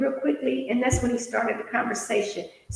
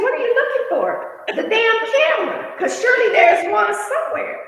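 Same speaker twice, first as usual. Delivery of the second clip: laughing retelling; 9 semitones higher, 3 dB louder than usual. Retold or imitated. Retold exchange, laughing.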